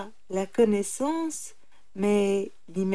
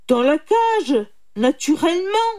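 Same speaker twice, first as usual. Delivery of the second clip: loud, exasperated snap; impatient tiger; 7 semitones higher, 8 dB louder than usual. A narrator's reading. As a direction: answer loudly, out of patience. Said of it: "s'impatienta le tigre". (tigre impatient, voix forte)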